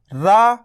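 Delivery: रा drawn out very long; stretched ra. Shown as a demonstration. Teacher slowly stretching रा for learners. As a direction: hold रा slowly. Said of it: "तो रा को खींचो".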